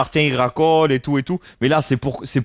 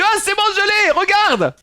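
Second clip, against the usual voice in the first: much higher, squeaky proud mock voice; imitating Shafik. Streamer imitating Shafik bragging about catching a mosquito, squeaky and proud. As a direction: play a friend showing off a caught mosquito. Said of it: "il dit".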